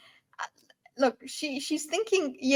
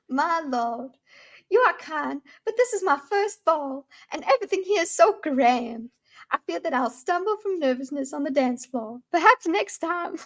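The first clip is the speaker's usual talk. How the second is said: put-on higher, sugary southern belle accent; 5 semitones higher than usual. Noble lady declining a dance, hammed-up southern belle accent. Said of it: "one take as a southern belle".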